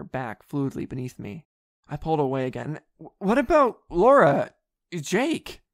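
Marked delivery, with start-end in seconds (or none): 3.2-5.6 higher, louder, slow questioning; urgent protest. A narrator's reading. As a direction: break away and object out loud. Narrator pulls away, protesting.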